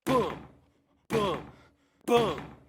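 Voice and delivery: short, high, loud falling booms; forceful imitated pounding; loud banging booms. A narrator's reading. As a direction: punch out each boom like a blow on a door. Banging on the door resumes.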